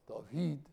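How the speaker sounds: David said as TAH-vee with a t sound; old Aramaic pronunciation of David. Shown as a demonstration. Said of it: "or they would have said back then".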